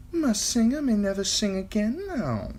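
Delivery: put-on high, playful young woman; Shay teasing Tyrion. A narrator's reading. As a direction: tease him lightly. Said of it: "she teased".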